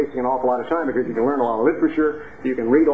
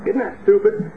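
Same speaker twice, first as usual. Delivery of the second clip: ordinary delivery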